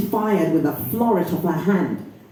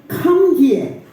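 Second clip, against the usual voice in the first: higher, sharp summons from the aunt; stern aunt command; 7 semitones higher, 3 dB louder than usual. Acted. Aunt ordering niece to come closer, stern. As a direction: call her over like a stern aunt.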